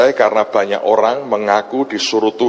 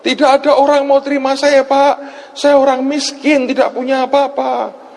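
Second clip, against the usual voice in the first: high-pitched mock wail of a woman caller; imitated tearful wailing; 12 semitones higher than usual. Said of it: "Dia nangis lebih keras". (menirukan ibu menangis meratap)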